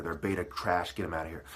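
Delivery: usual speaking voice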